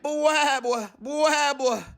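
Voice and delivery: put-on high loud baby bwah cries; baby shouting. A narrator's reading. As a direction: bawl like an upset infant. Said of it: "and shouted".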